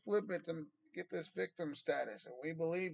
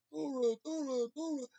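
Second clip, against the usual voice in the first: mocking sing-song; 8 semitones higher than usual. Mocking chant of the word.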